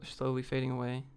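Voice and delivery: usual speaking voice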